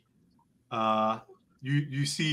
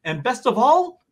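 much higher, louder gushing relief; relieved shopkeeper; 11 semitones higher, 5 dB louder than usual. Acted. Mister Pamplona thanking the party for saving them, high and gushing. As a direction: gush thanks like a relieved merchant. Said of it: "Mister Pamplona say".

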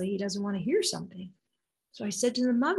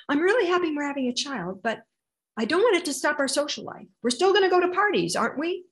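higher and louder, building to a near shout; loud husband voice; 6 semitones higher, 4 dB louder, faster than usual. Retold or imitated. Mother quoting her husband's loud talk, louder and higher. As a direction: play the booming husband who loves parties. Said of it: "he says this in a super loud voice".

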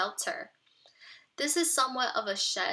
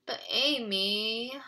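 slow, drawn-out groan; much slower than usual. Acted. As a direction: moan it out in protest. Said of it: "Tim groaned".

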